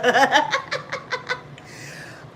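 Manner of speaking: high giddy laugh; laugh, delighted. Real, not acted. Laughing at story.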